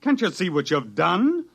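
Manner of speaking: angry outburst. Acted. Scientist berating colleague over published cure, angry. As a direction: rail at his partner in disbelief. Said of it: "there's no sense in getting excited".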